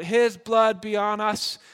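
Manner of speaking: loud, slow declaration; crowd calling out. Retold or imitated. Crowd calling out to Pilate, loud and slow. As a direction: call it out slowly to all. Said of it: "the crowd calls out".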